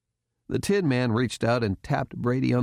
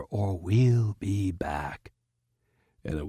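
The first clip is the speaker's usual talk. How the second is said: menacing whisper; 3 semitones lower, slower than usual. A narrator's reading. Whispered threat to agents.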